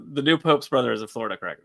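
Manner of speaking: ordinary delivery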